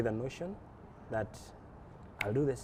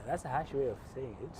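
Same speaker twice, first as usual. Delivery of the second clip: normal talking voice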